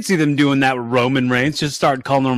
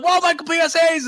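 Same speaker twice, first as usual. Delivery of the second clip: high-pitched excited exclamation; wowed fan; 12 semitones higher than usual. Retold or imitated. Retelling the surprise entrance, high mock amazement. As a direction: imitate a fan seeing a legend appear. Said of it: "you're like".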